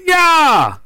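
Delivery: high, drawn-out distant holler; calling back. Retold or imitated. Buddy answers the hunter from far off, hollering back. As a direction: holler an answer across the woods.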